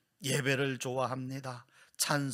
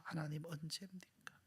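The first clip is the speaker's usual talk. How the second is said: 하나님, 언제입니까 whispered much softer; whispered plea; 3 semitones higher, 12 dB quieter than usual. Acted. Sufferer pleading with God, whispered.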